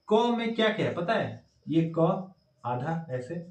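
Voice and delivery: normal talking voice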